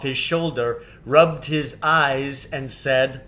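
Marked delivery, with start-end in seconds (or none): none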